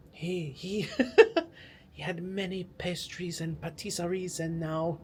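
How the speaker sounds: hammed-up French storyteller, laughing at himself; badly faked French play narration, drawn out; horrible French accent. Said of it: "Enough of my horrible impersonation".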